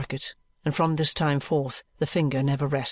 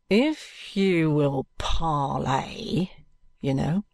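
parenthetically; much slower than usual. A narrator's reading. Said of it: "says Mister Bucket parenthetically".

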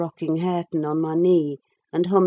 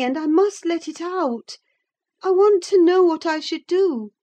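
put-on high-pitched young lady confiding; worried young mistress in a high voice; Catherine; 10 semitones higher, 3 dB louder than usual. A narrator's reading. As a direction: speak high throughout, urgent to unburden yourself. Catherine confides her dilemma to Nelly.

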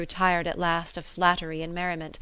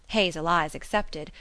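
usual speaking voice

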